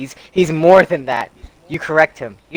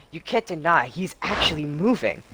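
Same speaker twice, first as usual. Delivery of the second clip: normal talking voice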